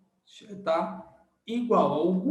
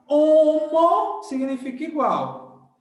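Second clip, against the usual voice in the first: HOMO said high, loud and slow; stressed rule; 7 semitones higher, 6 dB louder than usual. Shown as a demonstration. Teacher hammering the key rule home to students, loud and slow. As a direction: hammer home homo significa igual slowly. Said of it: "teacher stresses the key rule".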